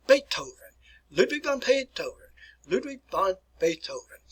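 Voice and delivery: "Beethoven" as "Bay-toh-ven"; "Ludwig von Beethoven" as a careful German model; German pronunciation. Shown as a demonstration. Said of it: "pronounce it properly as".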